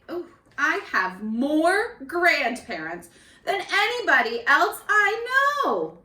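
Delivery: expressive storybook reading; picture-book narration for the class; reading to children. A narrator's reading. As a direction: bring each page alive for small kids. Teacher reads picture book aloud.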